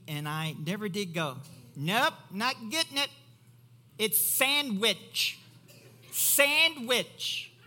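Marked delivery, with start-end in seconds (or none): 1.7-3.1 high, loud strict-parent act; mock stern refusal. 4.0-7.5 "sandwich" drawn out as "SAND-WICH", high and loud; over-enunciate both halves of "sandwich"; exaggerated correction. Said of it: "Say it right".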